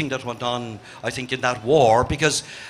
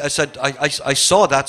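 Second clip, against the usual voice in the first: ordinary talk, nothing marked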